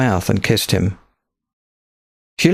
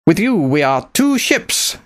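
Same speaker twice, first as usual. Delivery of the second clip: put-on higher, thick-accented rebel captain, proud; accented pirate captain; 6 semitones higher, slower than usual. A narrator's reading. Pirate captain recruiting Nicholas to her fleet, thick accent. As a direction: speak as a bold foreign pirate captain. Sophia speaks.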